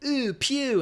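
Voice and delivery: high squealed ooh pew of disgust; mock disgust at a stink. Remembering the scratch and sniff cards, a high disgusted ooh pew. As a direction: squeal it like catching a bad smell. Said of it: "you'd scratch and".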